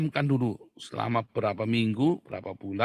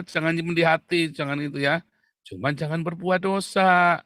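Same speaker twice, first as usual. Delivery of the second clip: preaching at full force; 5 semitones higher, 6 dB louder than usual. Acted. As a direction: hammer the point home to the congregation. Preaches loudly on anger. (berkhotbah lantang penuh semangat)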